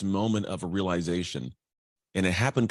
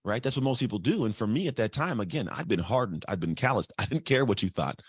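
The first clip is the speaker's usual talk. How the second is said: ordinary delivery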